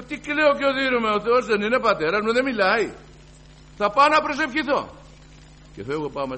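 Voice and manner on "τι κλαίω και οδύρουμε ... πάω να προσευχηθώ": much higher, louder determined outcry; resolute cry. Retold or imitated. Resolves to pray, shouting. (αποφασιστική κραυγή, πολύ ψηλά και δυνατά)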